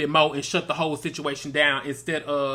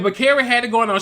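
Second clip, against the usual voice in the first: ordinary talk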